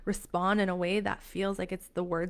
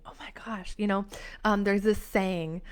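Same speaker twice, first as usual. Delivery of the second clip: normal talking voice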